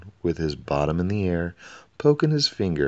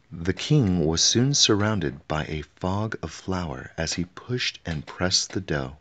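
plain reading voice

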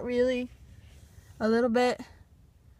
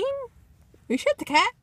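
sudden high squeak of doubt; surprised; 12 semitones higher than usual. Real, not acted. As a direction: jump up high in disbelief. Sudden doubt about the cat.

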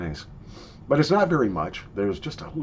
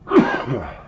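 short cough; 2 semitones higher, 6 dB louder than usual. Real, not acted. Still coughing after snuff.

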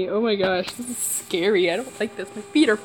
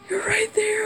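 frightened whisper, higher pitch; scared whisper; 9 semitones higher, 4 dB quieter than usual. Real, not acted. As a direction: breathe it out in fear. Monster right beside her.

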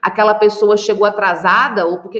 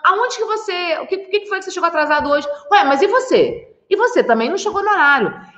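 high-pitched, stalling then turning it back; deflecting with questions; 7 semitones higher than usual. Acted. Wife dodges husband's question about lateness, acted deflection. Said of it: "ela te responde com uma outra pergunta".

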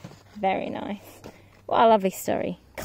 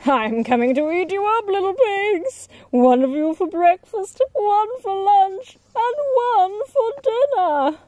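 much higher, gloating wolf, slowing on the meals; sniggering wolf; 11 semitones higher, 5 dB louder than usual. Acted. Wolf gloating at the pigs from the roof, sly and high. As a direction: gloat over the menu. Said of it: "The wolf sniggered".

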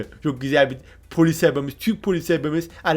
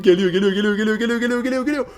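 building excitement; 4 semitones higher, faster than usual. Real, not acted. Commentator chanting as the car comes in, rising pitch. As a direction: chant geliyor climbing ever higher.

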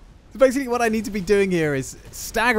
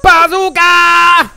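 long, high, drawn-out battle cry; yelling; 11 semitones higher, 12 dB louder, much slower than usual. Real, not acted. Player announcing the shot, yelling. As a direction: yell it loud, stretching the vowels. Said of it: "the reason I'm yelling it".

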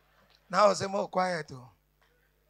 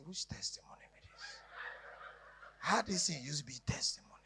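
hushed, puzzled whisper; soft whispered disbelief; whispered question; 6 semitones lower, 20 dB quieter, faster than usual. Acted. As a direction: whisper it like a scandalised congregant. Church asking confused, hushed.

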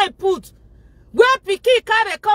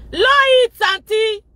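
shouted order; 7 semitones higher, 5 dB louder than usual. Real, not acted. Vlogger shouting at the viewer to turn her phone down. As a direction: bark the order up high. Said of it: "If it's too loud".

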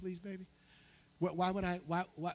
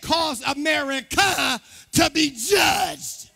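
slow, loud preacher's cry with weighty pauses; full-force preaching; 11 semitones higher, 12 dB louder, much slower than usual. Acted. Pastor pronounces judgment on America, preaching at full force. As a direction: hammer out each word from the pulpit.

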